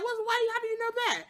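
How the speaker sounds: high, fast, baffled; puzzled father. Retold or imitated. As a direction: rattle the questions off in confusion. Ralph Angel puzzled by his son.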